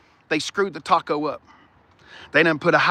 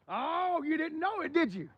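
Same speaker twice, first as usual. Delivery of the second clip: hushed tease; 10 semitones higher, 15 dB quieter than usual. Real, not acted. Teasing the car.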